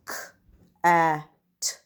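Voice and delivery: each letter sound said separately, with pauses between. Shown as a demonstration. Teacher to pupils, sounding out a word letter by letter. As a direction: split the word into its single sounds for the class. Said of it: "my next word I'm sounding out is".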